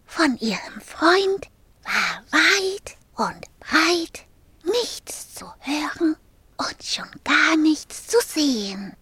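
usual speaking voice

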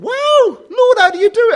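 high, loud, astonished exclamation; amazed. Retold or imitated. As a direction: gasp it out in delighted wonder, up high. Retelling his amazed thought.

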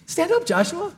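high, easy-going Stand up, Joshua; breezy, casual call to get up; casual God. Retold or imitated. God casually telling Joshua to stand up, high and easy. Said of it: "so kinda, like, casual".

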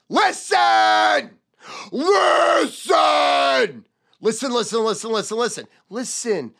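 loud, high listen, then easing off; shouted listen. Shown as a demonstration. Salesman demonstrating how to grab a customer. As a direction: shout listen to seize attention. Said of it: "Gets the customer's attention".

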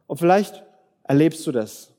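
normal talking voice

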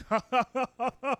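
exaggerated, rhythmic ha-ha-ha; mock laugh.

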